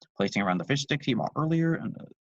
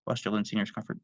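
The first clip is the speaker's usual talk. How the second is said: ordinary delivery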